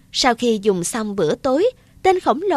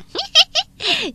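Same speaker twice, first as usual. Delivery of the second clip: giantess laugh. Acted.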